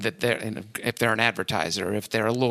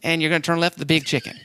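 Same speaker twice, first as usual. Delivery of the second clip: put-on Southern accent; 4 semitones higher than usual. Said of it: "I don't have a very good Southern accent".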